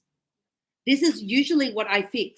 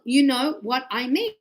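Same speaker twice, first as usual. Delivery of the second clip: word by word. Shown as a demonstration. Teacher spelling out fast speech, word by word. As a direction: separate every word of "you know what I mean". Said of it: "we don't speak like that".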